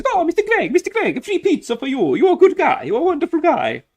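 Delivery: put-on very high, loud Italian accent, gushing; Italian restaurant owner. Italian owner greeting his favourite customer, high Italian accent. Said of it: "in an Italian, the Italian place".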